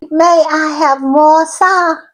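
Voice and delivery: light mock-British begging; English accent. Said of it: "my attempt at the English accent".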